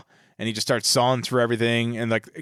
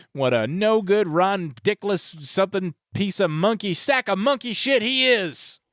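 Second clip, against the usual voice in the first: higher, slow, hammed-up tirade; mock Clark rant; 9 semitones higher than usual. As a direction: spit out the insults one by one. Clark's rant about his boss.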